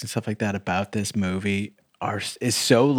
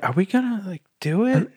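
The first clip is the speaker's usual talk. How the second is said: eager high voice; 10 semitones higher than usual. Retold or imitated. Voicing the waiter.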